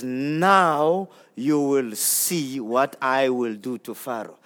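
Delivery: slow, low, soft solemn God. Acted. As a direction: lean into each word, slow and grave. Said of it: "he says".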